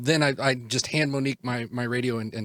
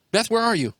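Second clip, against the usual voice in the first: high, raised-voice radio call; urgent calling; 11 semitones higher, 6 dB louder than usual. Acted. Roy radios his lost friend Beth, calling urgently.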